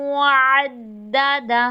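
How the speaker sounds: अद्ददा with a strong, held द्द; firm doubled dal. Shown as a demonstration. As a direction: hit the द्द of अद्ददा hard. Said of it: "दाल मुशद्ददा तो मजबूती से". (अद्ददा में दाल मज़बूती से)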